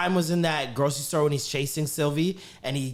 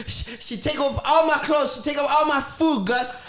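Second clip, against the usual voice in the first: Eddie Murphy character voice; 6 semitones higher than usual. Retold or imitated. Quoting the backyard bit to Gus, high comic whine. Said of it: "'Cause he's like".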